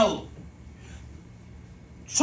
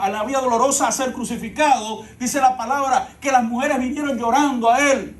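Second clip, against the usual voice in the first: normal talking voice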